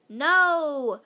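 loud group no. Acted. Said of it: "said all the boys".

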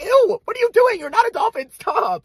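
disgusted squeal. Retold or imitated. Disgusted swimmer scolding kids at the pool, high ew. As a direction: recoil with a high ew. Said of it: "at each other, like".

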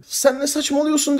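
high, heated mock protest; imitating flustered denial. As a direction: act caught out and rattled. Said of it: "bu kadar heyecanlandın, sinirlendin, bağırdın". (yüksek sesle, telaşlı taklit inkâr)

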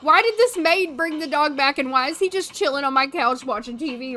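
normal talking voice

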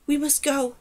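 mildly urgent warning; a touch of alarm. A narrator's reading. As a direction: press them to leave. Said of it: "said a slave urgently".

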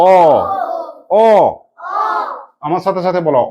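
অ held long as an open "aw". Shown as a demonstration. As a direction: draw out অ with rounded lips. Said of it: "এ কখনো অ উচ্চারণ হয়".

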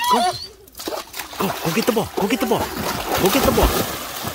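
high, urgent calling to a dog; calling from far away. Real, not acted. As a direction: shout encouragement across the water. Calling dog across pool.

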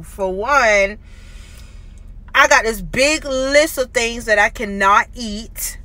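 lisping around brackets; slightly awkward tongue movements; talking with new braces. Real, not acted. Seen 0: Metal braces clearly visible on teeth; earlier frames show plain teeth.

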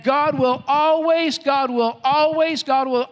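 high, loud, slow proclamation; ringing sermon climax, drawn out; emphatic preaching. Real, not acted. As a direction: drive each phrase home to the whole congregation. Preacher's climactic promise, repeated.

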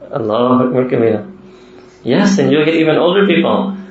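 ordinary talk, nothing marked

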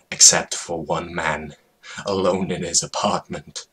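plain reading voice

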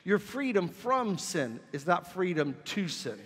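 normal talking voice

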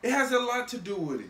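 high, loud retort; yelling. Real, not acted. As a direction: fire it back defensively. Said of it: "why are you yelling".

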